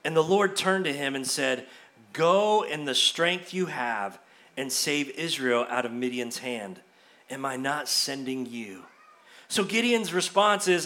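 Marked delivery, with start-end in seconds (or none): 2.1-8.8 low, soft and measured; a hushed, weighty divine command; solemn voice of God. Acted. God's voice, quoted to Gideon.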